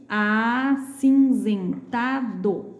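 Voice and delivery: "acinzentado" very slowly, syllable by syllable; Z sound. Shown as a demonstration. Teacher showing words with Z, slow clear Z in "acinzentado". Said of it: "palavras com Z".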